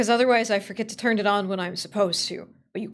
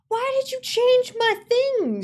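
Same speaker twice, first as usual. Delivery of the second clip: high, loud, drawn-out complaint; exasperated wail; 13 semitones higher, 7 dB louder, slower than usual. Real, not acted. Streamer blaming sound alerts for the change, exasperated wail. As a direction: wail it slowly at the culprit.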